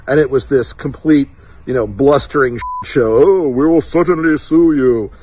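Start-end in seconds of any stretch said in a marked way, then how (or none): 3.2-5.1 a lightly put-on menacing Bane voice; mock the lawyer's threat like a comic villain. Strategist mocking the lawyer's letter in a lightly done Bane voice. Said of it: "We read it in the Bain voice".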